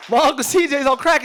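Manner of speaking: normal talking voice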